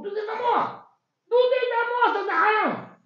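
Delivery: high, loud boasting; possessive shout. Acted. Laban shouting that everything is his, greedy. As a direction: claim it all, loud and greedy.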